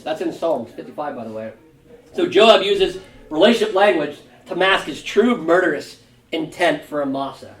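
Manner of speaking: ordinary talk, nothing marked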